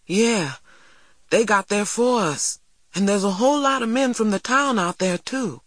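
a hint of an eager farm boy, quick; earnest young boy, lightly voiced; lightly voiced country boy. Jeremy's voice, eager report.

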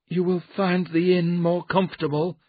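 high, restrained character voice; quiet warning. A narrator's reading. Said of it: "Mister Jerome said quietly".

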